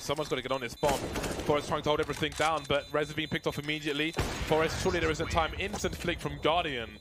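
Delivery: rising, fast hype casting call; excited play-by-play. Acted. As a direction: build the clutch round to a roar. Hype play-by-play of clutch round.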